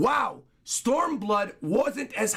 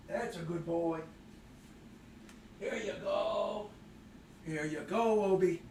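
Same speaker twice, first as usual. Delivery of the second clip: soft, drawn-out coaxing to a pet; soft praise to dog; 2 semitones lower, 10 dB quieter than usual. Real, not acted. Streamer praising Obi for coming to sit, softly coaxing. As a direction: gently soothe and reward the dog.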